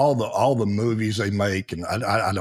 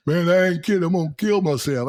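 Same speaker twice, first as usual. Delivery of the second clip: whiny soldier; 7 semitones higher than usual. Retold or imitated. Soldier threatens suicide, voiced.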